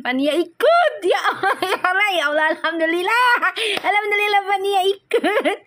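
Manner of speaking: high-pitched laughing excitement; real delighted squeals between words; overjoyed. Real, not acted. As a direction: laugh through repeated thanks to God. Thrilled that Fania is going too.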